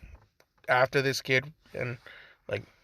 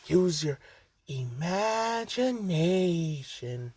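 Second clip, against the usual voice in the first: slow, dreamy, teasing flourish; playful drawn-out; 3 semitones higher, 4 dB quieter, much slower than usual. Pretend. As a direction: stretch it out very slowly, playfully. Playful showy catchphrase to listeners.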